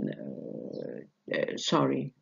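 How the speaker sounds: ordinary talk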